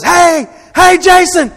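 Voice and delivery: high excited shout across the room; brother's shout. Retold or imitated. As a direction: call out loud and high, waving. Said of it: "My brother, Matt, goes".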